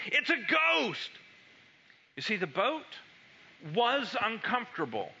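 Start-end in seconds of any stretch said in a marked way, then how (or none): 0.1-1.1 higher, slow mock-frightened cry; terrified cry. Frightened disciples spotting a figure on the waves, higher mock cry. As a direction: yelp it like the water holds a ghost. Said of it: "they cried out".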